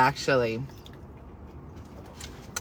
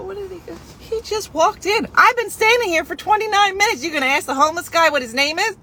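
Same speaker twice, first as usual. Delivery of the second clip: indignant rant; 10 semitones higher than usual. Retold or imitated. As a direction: let the outrage climb up high. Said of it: "I go".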